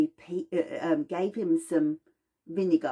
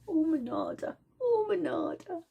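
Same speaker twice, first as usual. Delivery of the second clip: high, adoring sighs of her name; swooning relief; 12 semitones higher than usual. Acted. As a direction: melt with gratitude toward the oil. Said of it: "You go".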